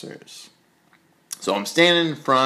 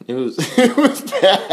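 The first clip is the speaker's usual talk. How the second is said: real laugh breaking into the words, higher and louder; 8 semitones higher, 5 dB louder than usual. Real, not acted. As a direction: laugh through the admission. Laughing at own embarrassing story.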